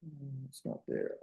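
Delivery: distant, faint voice from across the room; away from the mic. Real, not acted. Teacher searches for the page across the room, faint and distant. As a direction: keep talking while you walk away from the microphone. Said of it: "Let me just back over there".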